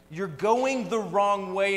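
slow emphatic warning; preached call-out. Retold or imitated. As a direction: urge them to turn around. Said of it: "he's yelling at us".

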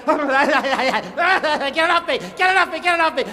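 high, slow, laughing mock panic; dreaming man's panic. The dreaming soldier cries out in hammed-up terror. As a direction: ham up a sleeper's nightmare cries. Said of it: "he hears this guy say".

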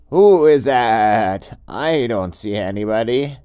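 loud, slow puzzled goat voice; confused goat. Acted. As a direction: goat looking round, baffled. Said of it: "the goat was really confused".

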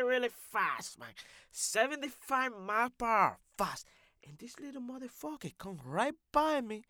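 put-on accented loudmouth, emphatic on key words; boastful comic character. Character telling how Annie's brother passed him, indignant and animated.

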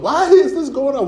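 high mock soap-opera wail; mock melodramatic sob-voice; soap opera crying. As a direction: ham up a weeping soap star. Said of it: "they start crying on the soap".

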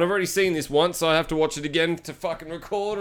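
ordinary delivery

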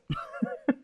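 thin high giggle; high squeaky laugh. Real, not acted. Host giggling at the name question, squeaky laugh.

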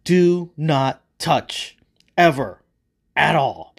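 loud, very slow, separated commands; booming like thunder. Acted. God laying down the law on the tree, booming. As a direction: hammer each word out with a pause. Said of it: "God's voice boomed like thunder".

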